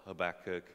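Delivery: Habakkuk said with stress on the middle syllable; alternative pronunciation. Shown as a demonstration. Preacher offering another option, Habakkuk pronounced huh-BAK-uk. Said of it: "whatever you wanna pronounce it with".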